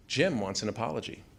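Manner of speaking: ordinary delivery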